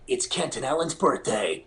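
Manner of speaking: imitated radio imaging voice; switched-on announcer voice. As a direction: turn on the put-on promo voice. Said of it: "he just turned it on".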